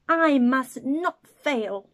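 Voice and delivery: loud, slow and resolute; determined snail. Acted. Tiny snail vowing to save the whale, slow and resolute. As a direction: plant each word firmly.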